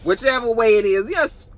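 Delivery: ordinary talk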